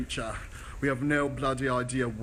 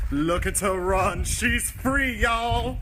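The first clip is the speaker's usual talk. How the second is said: put-on higher, louder, accent dropping to American; accent slipping; 9 semitones higher, 4 dB louder, slower than usual. [name] cheering Olivia's run, accent slipping. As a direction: break character, cheering. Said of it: "what he did with his accent at the end".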